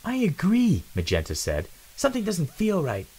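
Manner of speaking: high, worried female mouse; uneasy female voice. Acted. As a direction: sense something is off.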